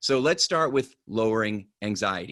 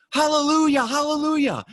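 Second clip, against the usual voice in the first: much higher and louder frantic cry; desperate shout; 6 dB louder than usual. Acted. Rider at the cliff edge yelling the stop command, frantic high shout.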